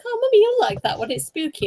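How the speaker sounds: put-on high, excited little-boy voice; Nathan. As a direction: imitate a small son eager to show Mummy. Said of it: "And Nathan's like".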